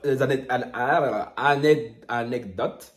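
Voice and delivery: stumbling over "anecdotes", restarting it in pieces; struggling with a word. Real, not acted. YouTuber stuck on a tricky word, stumbling through "anecdotes". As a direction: trip on "anecdotes", breaking off after "anec" and retrying. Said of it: "j'ai du mal avec ce mot".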